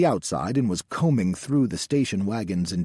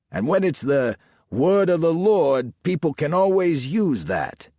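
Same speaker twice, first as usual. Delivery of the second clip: higher, louder Jersey salesman patter; cocky Jersey accent; 7 semitones higher, 6 dB louder than usual. A narrator's reading. Salesman bluffing the sheriff about Bibles, cocky Jersey accent. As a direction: sell it with Jersey bravado.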